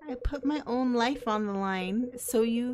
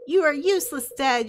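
louder, higher snapping at dad; angry outburst; 3 semitones higher, 6 dB louder than usual. Acted. Daughter lashing out at her useless dad. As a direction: throw it at him in fury. Ari berates her father.

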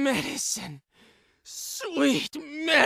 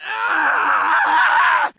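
loud scream of torment; drawn-out anguished yell; long loud scream; 6 dB louder than usual. Acted. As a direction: scream at the visions, holding it.

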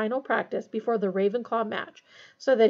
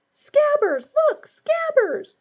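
high, loud frantic yell; 9 dB louder, much slower than usual. Acted. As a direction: shout the rat's name in alarm. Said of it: "he yelled".

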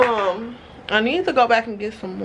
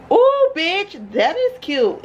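excited; 8 semitones higher, slower than usual. Real, not acted. Excited over new purse.

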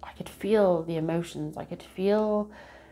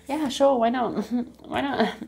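giddy inner yes; 6 semitones higher, 3 dB louder than usual. Acted. Inner voice thrilled by a date offer.

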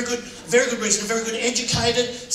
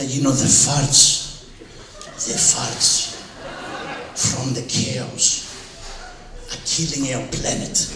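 mock preachy relatives; 12 semitones lower, 4 dB quieter, slower than usual. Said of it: "They go".

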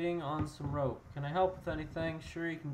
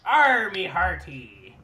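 slow, much louder hearty call; pirate shout; 2 semitones higher, 11 dB louder, much slower than usual. Pretend. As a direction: bellow it like a ship captain. Pirate greeting again.

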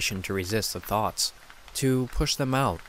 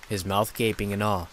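ordinary delivery

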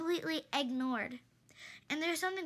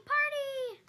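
party cheer; 9 semitones higher, 3 dB louder than usual. Acted. Character chanting about parties.

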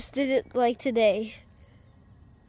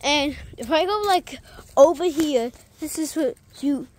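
ordinary delivery

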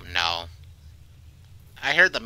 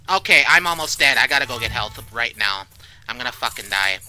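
usual speaking voice

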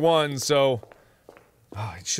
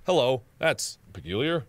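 normal talking voice

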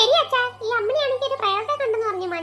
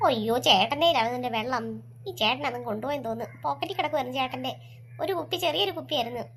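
reassuring mother, lower pitch; 8 semitones lower than usual. Acted. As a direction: lower voice of a mother calming her child.

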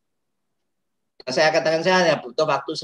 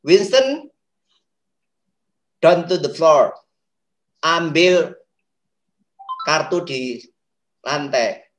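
firm command; 4 dB louder, slower than usual. Shown as a demonstration. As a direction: give a firm, clear instruction to a child. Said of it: "tapi firm, tegas". (perintah tegas kepada anak)

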